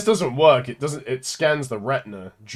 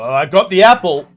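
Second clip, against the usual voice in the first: triumphant; 6 semitones higher, 10 dB louder than usual. Real, not acted. Triumphant about finding apple.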